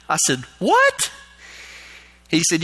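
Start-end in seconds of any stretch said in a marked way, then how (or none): 0.6-1.1 very high, rising yelp of disbelief; retold startled high question; shocked disbelief. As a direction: squeak it out in mock shock. Retold reaction to alarming news.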